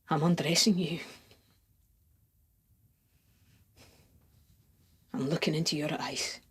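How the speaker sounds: very soft, intimate near-whisper; hushed seduction. Acted. Lover imagining kisses with the beloved, hushed and intimate.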